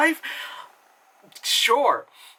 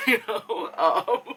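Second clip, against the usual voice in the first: ordinary talk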